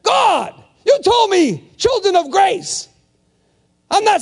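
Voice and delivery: high, loud cry to God; insistent shouted prayer. Acted. Preacher praying like a bulldog, insistent shout.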